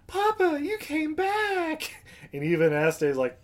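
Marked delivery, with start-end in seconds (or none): 0.1-1.9 put-on high, slow excited little girl. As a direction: cry it out like a joyful child. Molly greets Entei as Papa.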